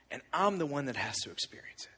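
whispered, barely voiced; a child's whispered confession; soft whisper. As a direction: let it drop to a hushed whisper. Daughter's words, whispered.

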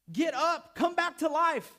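loud, high urgent command; yelling. Acted. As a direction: shout it to rouse a sleeper. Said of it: "when Jesus yells at the man".

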